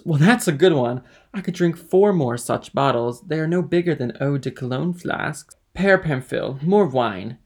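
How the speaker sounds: loud, tipsy bravado; boastful drunkard. A narrator's reading. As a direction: boast noisily like a merry drinker. Drunkard boasts, calls for more wine.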